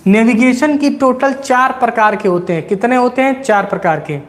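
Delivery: ordinary talk, nothing marked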